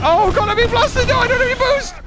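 high, panicked pleading; panicked high cries. Real, not acted. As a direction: panic while being blasted. Being attacked in game.

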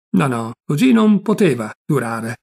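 ordinary delivery, nothing marked

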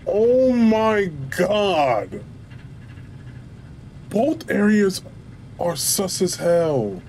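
high, slow, spooked; creeped out, voice up high; spooked and shaky. Real, not acted. Spooked by the containment room.

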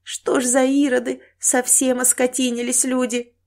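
plaintive, crying complaint; tearful lament. Acted. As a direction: lament cruel people through tears. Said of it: "расплакалась добрая женщина".

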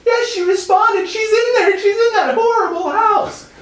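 very high, loud falsetto hysterics; hammed-up panicked girl; mock frantic shriek. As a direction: scream it like an overdramatic rescuer. Panicked friend imitated in falsetto.

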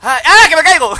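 alarido al caerse; grito de susto. Real, not acted. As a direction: grita mientras se cae. Se cae en el juego. (grito agudo de susto)

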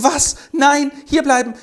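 high, loud pleading protest; desperate outcry. Acted. Helene begging Loth to stay, crying out desperately. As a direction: cry out to stop him leaving.

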